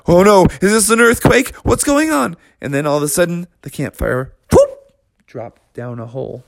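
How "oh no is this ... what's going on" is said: panicked bears. Acted.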